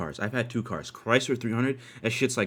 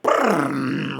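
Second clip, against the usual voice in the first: imitated engine rev; dirt bike engine; 3 dB louder than usual. Rider starting his dirt bike, imitated rev. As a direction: trill it like a motor starting.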